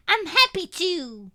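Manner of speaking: put-on high, loud, slow shrill toddler voice; a small child shrilling with delight; delighted little girl. A narrator's reading. Little girl answering her father, shrilling delightedly. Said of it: "she shrills, delighted".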